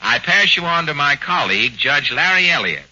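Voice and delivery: ordinary talk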